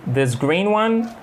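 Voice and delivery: "This green one?" said slowly, climbing high on "one"; rising question. Shown as a demonstration. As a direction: ask back to clarify, rising high. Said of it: "Listen to how rise in intonation is used to ask for".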